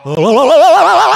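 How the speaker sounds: high, slow, loud drawn-out alarm; alarm shout. Acted. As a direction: sound the alarm, stretching every word. (протяжный тревожный крик)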